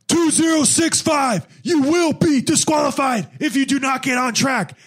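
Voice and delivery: imitated official's yell. Course official warns a straying swimmer, mock yelling. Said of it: "I did get yelled at".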